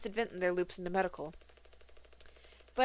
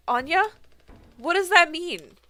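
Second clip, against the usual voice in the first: high, loud shocked question; sudden alarm breaking through; 9 semitones higher, 10 dB louder than usual. Acted. Alarmed at Anya's words.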